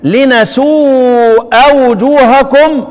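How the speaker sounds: very slow recitation of لنسوء with nun; recited reading. Shown as a demonstration. Teacher quizzing students on which reading, slow chant. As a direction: chant لنسوء وجوهكم, stretched. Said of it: "طب لو سمعت".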